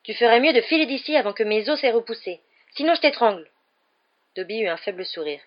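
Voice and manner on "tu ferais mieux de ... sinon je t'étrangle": loud, high, ferocious threat; raised voice, fierce warning. A narrator's reading. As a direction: threaten with rising fury. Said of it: "dit-il d'un ton féroce".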